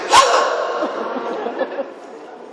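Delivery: sharp shocked intake of breath, airy; retold gasp. Actress recalls seeing the Enterprise nuked, gasping. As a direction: gasp as if the Enterprise just blew up. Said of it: "I actually went".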